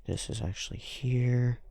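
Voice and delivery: normal talking voice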